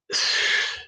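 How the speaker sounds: long, breathy exhale; exasperated sigh. Real, not acted. Man explaining it's not that difficult, exasperated sigh. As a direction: let out a weary, voiceless sigh.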